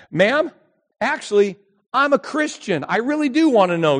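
high, earnest insisting; pleading. Retold or imitated. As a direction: convince her.

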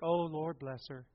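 soft, lightly voiced church lady; imitated church ladies. Church ladies with hankies over the crying girl, imitated. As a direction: coo it quietly like a touched church lady. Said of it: "they get their hankies".

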